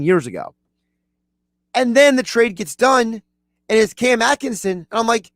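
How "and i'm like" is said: usual speaking voice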